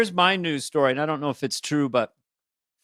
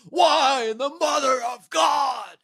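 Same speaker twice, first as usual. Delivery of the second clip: high, slow anguished shout; Nicolas Cage; 10 semitones higher, slower than usual. Acted. Actor venting over an IRS audit, overwrought shout. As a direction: wail it out, hugely hammed up. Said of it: "you just call Nicolas Cage".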